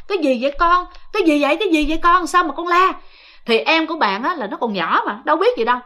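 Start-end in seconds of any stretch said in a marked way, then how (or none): 0.1-2.9 jolted awake, asking what happened; anxious mother calling to her child; alarmed asking. Retold or imitated. Mother woken by child's scream.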